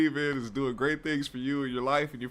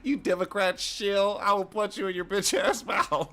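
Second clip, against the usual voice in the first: higher, giggling imitation of a tough commenter; mock his threat while cracking up; laughing mock tough guy; 5 semitones higher than usual. Streamer mocking an angry commenter, laughing mock threat. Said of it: "he's mad as shit".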